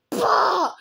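high loud startled shout bah; panicked shriek. Real, not acted. Gamer startled by a Titan, panicked shriek. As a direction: yelp in real panic.

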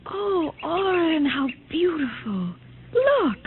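slow, softening, breaking with emotion; overwhelmed by the light; tearful wonder. Acted. Wife waking to sunlight, tearful wonder.

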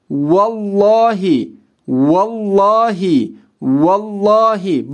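vallahi read with the lam thick and dark; slow vallahi, dark lam. Shown as a demonstration. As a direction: give vallahi a heavy, full lam. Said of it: "Bakın kalın okudum".

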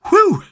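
high, loud "whew"; relieved whoop. Real, not acted. Player finishing a hard level, relieved whoop.

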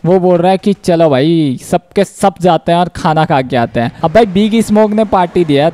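ordinary talk

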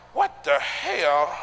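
slow, stretched-out disbelief; shocked. Retold or imitated. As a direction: drag out the shock. Man shocked at buffet.

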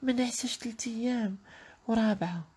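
high girlish voice, mock tired; mock sad girl. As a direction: whine high like a weary girl.

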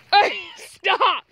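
high, loud cry to stop; scolding a dog. Real, not acted. Vlogger tries to stop the dog, loud scolding. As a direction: shout the dog off.

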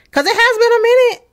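high, fast, teasing line; teasing squeal. Real, not acted. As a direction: tease them, squeaky and quick. Teasing about their long wait.

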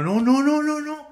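loud, high frantic protest; panicking gamer. Real, not acted. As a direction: cry out no in a panic. Player about to die in game.

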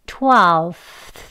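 twelfth with the tongue between the teeth and air blown out at the end; careful twelfth. Shown as a demonstration. Teacher repeating twelfth for students, breathy th ending. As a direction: blow the th of twelfth out slowly. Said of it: "한 번 더요".

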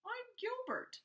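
small shy hello in a high voice; high little voice introducing himself; Gilbert introducing himself. Acted. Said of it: "said Gilbert".

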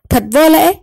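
high, loud outraged king shouting; angry king. A narrator's reading. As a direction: bark it out as a furious king. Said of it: "Vua cả giận nói". (vua giận dữ quát to)

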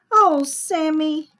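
drawn-out, loud teacher's groan. A narrator's reading. Teacher to Sammy who bit his painting, groaned. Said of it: "groaned the teacher".

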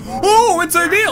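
high, loud burst of surprise; excited outburst. Real, not acted. Player discovering what's inside the mushroom, excited. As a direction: exclaim it way up high.

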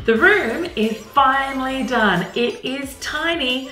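bright, eager room tour; gushing makeover reveal. Acted. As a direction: show off the room proudly. Presenting the finished room.

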